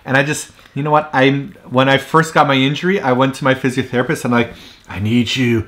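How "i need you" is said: low, measured retold request; retold earnest plea. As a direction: lower your voice, plead slightly mock-seriously. Retells plea to his physiotherapist.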